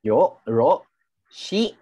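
normal talking voice

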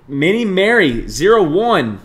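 slow, high, loud drawn-out name; excited shout-out. Real, not acted. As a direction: stretch the donor's name out loudly. Calls out another gift giver.

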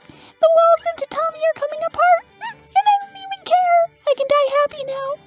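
very high, swooning fan voice; blissful sparrow fan. Acted. Fan in bliss as the room falls apart, high thrilled voice. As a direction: gush in a tiny high voice.

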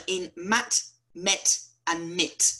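mat, met and mit, each said slowly and clearly with a short vowel; short vowels demo. Shown as a demonstration. Teacher to students, slow clear short-vowel demo. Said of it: "look at these short vowels".

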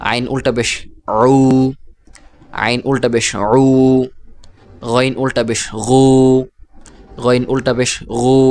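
closing উ and গু each held long; long madd vowel. Shown as a demonstration. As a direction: spell evenly, then stretch the final উ or গু. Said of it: "এইভাবে উচ্চারণ করতে হবে".

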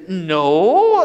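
loud, flat no; mock firm refusal. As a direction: shut it down firmly. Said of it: "And he says".

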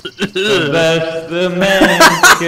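slow sung line drenched in reverb. Real, not acted. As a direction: croon it through echoing reverb. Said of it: "You sound like you're in your fucking bathroom".

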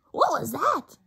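high, loud little bird; a light baby bird voice. Acted. Baby bird Flap startled, lightly voiced high cry. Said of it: "cried Flap".

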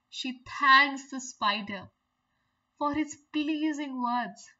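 ordinary delivery

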